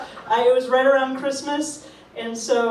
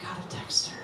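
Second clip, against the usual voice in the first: quiet musing to herself; 5 semitones lower, 12 dB quieter than usual. Retold or imitated. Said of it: "I was like".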